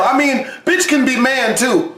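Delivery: ordinary talk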